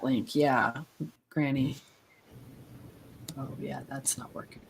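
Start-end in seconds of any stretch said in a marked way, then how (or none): 3.4-4.6 whispered aside, barely voiced; whispering. Real, not acted. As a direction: whisper it off-mic. Aside while host talks.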